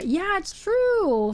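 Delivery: slow, high drawn-out mock agreement; sarcastic. Real, not acted. Streamer mocks chat's claim, slow and sarcastic. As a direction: stretch it out, clearly not meaning it.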